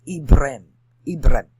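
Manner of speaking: Ibrahim without the H, "ib-RIM", loud; H dropped. Shown as a demonstration. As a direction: punch out Ibrahim as two syllables, no H. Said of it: "before the H".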